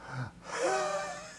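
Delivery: long, high wheezing exhale through tight airways; imitated asthma wheeze. Shown as a demonstration. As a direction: breathe out with a thin, whistling wheeze. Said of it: "it sounds a little bit like this".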